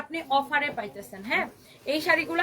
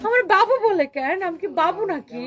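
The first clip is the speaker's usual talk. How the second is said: a touch of higher, louder surprise; puzzled retold question; 5 semitones higher, 6 dB louder than usual. Seller retelling her old misreading to viewers, mock puzzled. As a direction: mildly imitate her own confusion. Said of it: "তখন আমি বলতাম".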